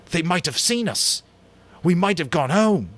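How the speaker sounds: high, loud, bitter rebuke; bitter anger. Acted. Ralph confronts Jack over the lost ship, bitter. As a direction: throw the lost rescue at him.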